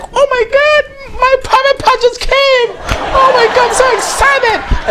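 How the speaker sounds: very high, slow excited squeal; squealing excitement. Pretend. Bride squealing over her new private part, very high and excited. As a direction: shriek with delight as the bride. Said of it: "And she's like".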